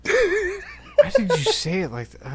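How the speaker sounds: laughing question. Real, not acted. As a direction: ask while cracking up.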